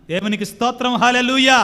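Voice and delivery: joyful pulpit hallelujah; loud hallelujah. Acted. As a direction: lift the hallelujah up like a shout of praise. Preacher's answer to the caller.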